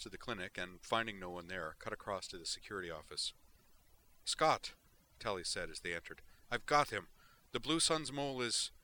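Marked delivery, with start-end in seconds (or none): 4.2-8.7 louder, higher, breathless urgent news; excited rush. Acted. Tally bursting into security office with news, excited rush. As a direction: blurt it out as you burst in.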